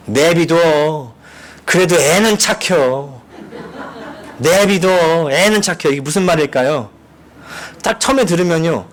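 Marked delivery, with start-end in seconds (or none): 0.1-5.9 very slow, drawn-out 내비둬; Chungcheong drawl. Retold or imitated. Preacher acting out the harshest Chungcheong insult, slowly. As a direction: leave him be, in a slow country drawl. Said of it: "이 얘기예요".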